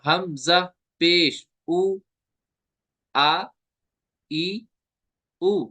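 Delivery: हमजा पेश उ spelt slowly, then आ, ई, उ apart; even medium vowels, neither dragged nor chopped; gentle Arabic reading. Shown as a demonstration. Teacher modelling short vowels, slow and evenly released. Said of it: "इस तरह".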